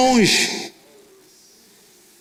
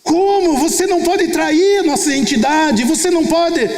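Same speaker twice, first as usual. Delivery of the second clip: husband protesting; 7 semitones higher than usual. Retold or imitated. Husband protests against leaving.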